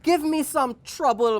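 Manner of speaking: high-pitched begging monkey voice; storyteller's eager little plea; pleading monkey. A narrator's reading. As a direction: beg it high, drawing out please. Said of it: "Monkey said".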